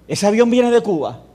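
loud high alarmed question; officer shouting his suspicion at the pastor. Retold or imitated. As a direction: demand it, shocked and loud.

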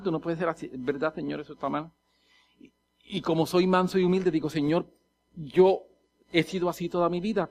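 soft, low confession to God; subdued and penitent; humble inner confession. Acted. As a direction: confess it quietly, as in private prayer.

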